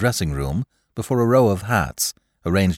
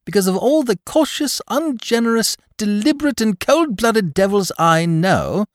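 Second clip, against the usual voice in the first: high, louder mock exasperation; exasperated Lord Peter; 5 dB louder than usual. Lord Peter berating cautious Parker, high and exasperated. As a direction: pile up the insults, high and loud.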